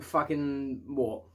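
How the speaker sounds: ordinary talk, nothing marked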